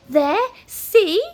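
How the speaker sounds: high, loud exasperated yell. A narrator's reading. Wizard berates the house, yelling. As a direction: yell it accusingly, pitched high. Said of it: "Flabbergast yelled at the house".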